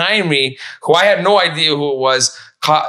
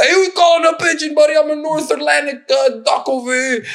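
much higher, cartoonish offended duck voice; 13 semitones higher than usual. Duck insulted at being called a pigeon, cartoonish high voice. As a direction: squawk back at the insult like a proud duck.

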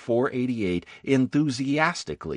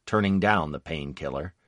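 usual speaking voice

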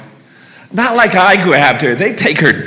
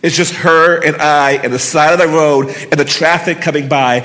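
ordinary delivery

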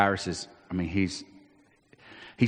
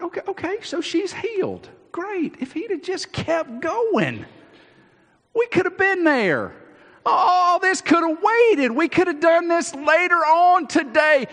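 high, pitched-up frustrated complaint; fed up it all took so long; exasperated. Acted. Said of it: "I'm like".

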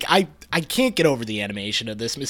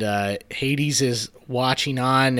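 normal talking voice

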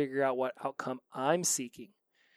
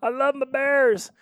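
much higher and louder, squealing over the bears; gushing future self; 11 semitones higher, 9 dB louder than usual. Pretend. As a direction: gush with delight, stretching I love my bears. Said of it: "listen to this episode and be like".

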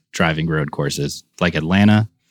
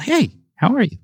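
high bright mock-friendly greeting; friendly staffer. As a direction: imitate a cheerful staffer saying hi. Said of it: "they'll be like".

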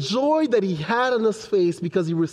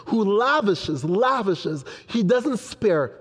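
ordinary talk

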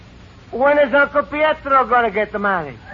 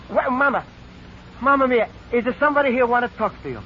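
ordinary talk, nothing marked

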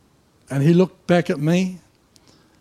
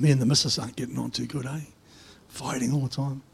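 confiding softly; 4 semitones lower, 8 dB quieter, faster than usual. Retold or imitated. Worker confiding in the pastor, low and soft. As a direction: lower and softer, hushed.

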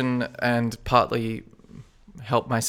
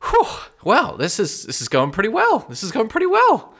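much higher, relieved cheery voice; Akal's voice; 10 semitones higher, 4 dB louder than usual. Acted. Akal to the party after the fight, high relieved cheer. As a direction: pitch up into a light, relieved sidekick. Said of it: "Akal turns to you and goes".

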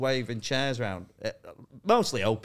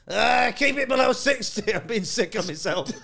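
higher, louder, slowed whine of a queasy passenger; mock carsick passenger; 11 semitones higher, 6 dB louder than usual. A comedian mocking his carsick co-host hanging out the window, whiny plea. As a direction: groan it like someone leaning out a car window.

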